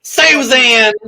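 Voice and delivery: Susan! high, loud Southern call; Southern accent. Shown as a demonstration. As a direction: lean into a Southern twang. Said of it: "I just lean into my southern accent".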